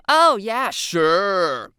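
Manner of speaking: loud, dripping sarcasm; high, exaggerated mock agreement; sarcastic. A narrator's reading. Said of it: "dripping with sarcasm".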